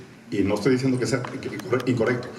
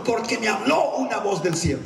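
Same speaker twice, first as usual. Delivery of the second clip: high, emphatic justification; insistent church member; 9 semitones higher than usual. Acted. Church member justifies himself to pastor, high voice. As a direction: answer in a raised voice.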